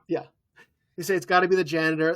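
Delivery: ordinary delivery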